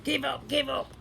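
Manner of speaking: high, excited cry of the robbers; gleeful high cry. A narrator's reading. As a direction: yell it at the pinned officer. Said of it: "the robbers cried".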